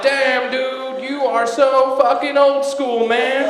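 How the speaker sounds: high, slow, drawn-out amazement; mock excited exclamation. Teasing Bobby about feeding lions, high drawn-out awe. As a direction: hype him up like a fan.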